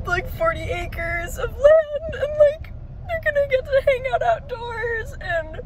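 high, crying voice, words stretched; tearful joy. Real, not acted. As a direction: choke up and squeak through happy tears. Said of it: "these are mostly tears of joy".

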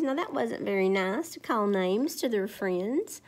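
ordinary delivery

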